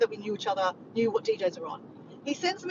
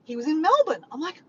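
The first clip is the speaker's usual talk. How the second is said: normal talking voice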